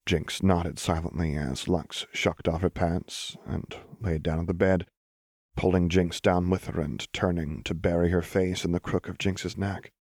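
ordinary delivery

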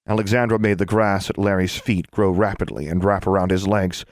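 usual speaking voice

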